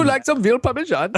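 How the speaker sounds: high, hammed-up Italian waiter gone New Delhi; mock Italian-Indian accent. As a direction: offer it in a silly Indian-tinged Italian accent. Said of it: "give us an Italian accent".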